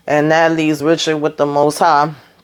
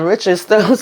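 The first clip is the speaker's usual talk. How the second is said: usual speaking voice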